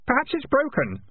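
high, innocent-sounding suggestion; helpful. Acted. Keith needling the failing piper, high and helpful. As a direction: suggest it helpfully, pitched well up. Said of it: "said Keith helpfully".

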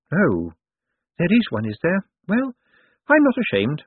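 put-on high-pitched old lady voice; Topsy Lavish. A narrator's reading. Dead woman excuses herself to Death, high and pert.

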